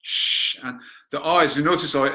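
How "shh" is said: long unvoiced hush; healing shh sound. Shown as a demonstration. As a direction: hush it out like calming a child.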